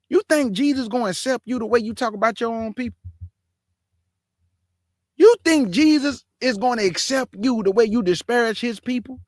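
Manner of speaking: high-pitched, insistent questioning; indignant challenge. Real, not acted. Commentator challenging a man's faith over his own people, indignant.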